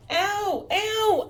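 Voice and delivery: sharp high yelp of pain; ow, a high startled cry; sudden pain. Real, not acted. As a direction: yelp as the puppy nips. Puppy bites her toe.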